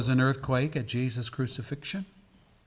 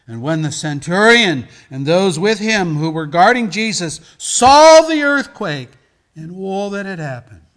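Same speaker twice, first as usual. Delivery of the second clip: loud, high, thundering Gospel reading; preaching scripture at full force; 7 semitones higher, 17 dB louder than usual. Acted. Reads Gospel, building to shouts.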